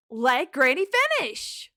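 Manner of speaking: loud, high yell; loud, high-pitched telling-off; scolding shout. Acted. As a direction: shout it to cut off the interrupter. Acted scolding of an interrupter.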